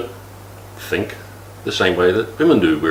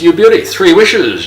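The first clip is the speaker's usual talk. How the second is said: excited; 8 semitones higher, 7 dB louder than usual. Retold or imitated. Excited bloke in genie joke.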